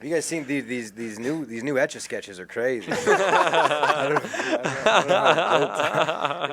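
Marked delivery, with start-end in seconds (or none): 2.9-6.5 high, slow laughing words. Real, not acted. Laughing through embarrassment.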